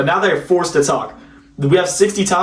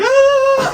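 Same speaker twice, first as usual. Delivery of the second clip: very high mock shriek; imitated scream. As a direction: squeal like a friend laughing himself into screams. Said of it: "He literally just starts screaming".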